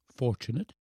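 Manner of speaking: put-on small, soft boy's question; doubting boy. A narrator's reading. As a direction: query it softly as a sceptical child. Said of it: "said the boy".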